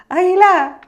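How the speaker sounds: loud high call across the sky; shouting out. Acted. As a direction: call out to the eagle far above. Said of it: "Y entonces le grita".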